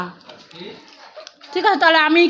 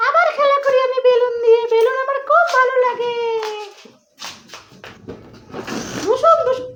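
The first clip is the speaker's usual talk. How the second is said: put-on much higher, a small child begging for the balloon; toddler voice; 9 semitones higher than usual. Mother voicing her toddler asking for the balloon, high childlike plea. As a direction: plead like a little kid asking mama.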